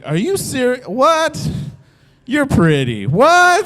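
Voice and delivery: high, rising stunned questions; can't believe his luck; disbelief. Retold or imitated. Stunned by her Vegas offer.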